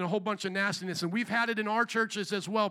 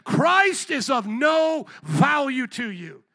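ordinary talk, nothing marked

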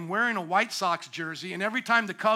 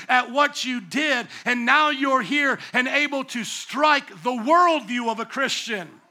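preaching at full force; 4 semitones higher, 4 dB louder than usual. Acted. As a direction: build each line like a pulpit climax. Preacher building to a peak.

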